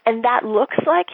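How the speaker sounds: ordinary delivery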